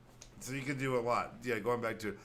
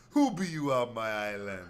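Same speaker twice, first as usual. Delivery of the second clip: mock Jamaican; 5 dB louder than usual. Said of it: "crazy Jamaican accent".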